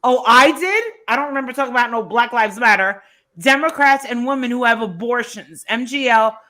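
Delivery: ordinary talk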